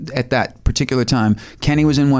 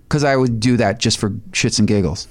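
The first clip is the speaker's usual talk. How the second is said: ordinary talk, nothing marked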